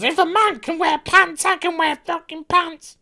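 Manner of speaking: shrill-free high mocking voice of a preachy heroine; mock the preachy line in a high voice. Mock feminist rant voice.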